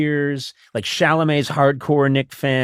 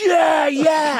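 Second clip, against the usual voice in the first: high shouted fan cheer; 11 semitones higher, 3 dB louder than usual. Pretend. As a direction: whoop like an overeager fan. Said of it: "And I'm like".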